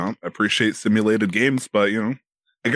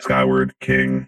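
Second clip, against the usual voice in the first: robot voice; 9 semitones lower, slower than usual. Acted. Robot voice repeats handle.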